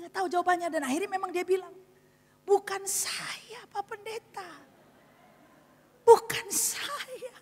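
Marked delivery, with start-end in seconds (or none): 2.5-4.5 high, drawn-out whimper; slow, high-pitched mock weeping; tearful denial. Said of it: "Dia nangis". 6.1-7.3 very high, drawn-out mock wail; hammed-up slow sobbing plea; crying denial. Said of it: "Dia nangis".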